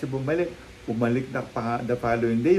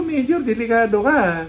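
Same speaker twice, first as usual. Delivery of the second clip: urgent warning; 9 semitones higher, 6 dB louder than usual. Retold or imitated. Warning the major.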